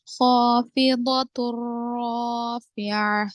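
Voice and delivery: slow, drawn-out tajweed chant; Quranic recitation. Shown as a demonstration. Tajweed teacher reciting for students, slow measured chant. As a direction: recite the verse slowly with elongated vowels. Recites verse demonstrating heavy letters.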